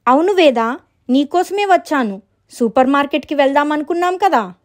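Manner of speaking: high storybook visitor; visiting friend character. Acted.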